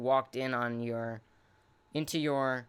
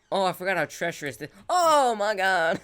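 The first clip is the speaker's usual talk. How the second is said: startled fright; 6 semitones higher, 7 dB louder than usual. Real, not acted. Scared in the deep dark.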